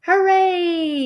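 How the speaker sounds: high, long celebratory cheer; yelled cheer. A narrator's reading. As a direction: cheer like a delighted crowd. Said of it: "yelled the crowd".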